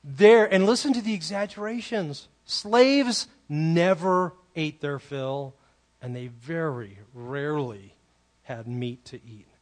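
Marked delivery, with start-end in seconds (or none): none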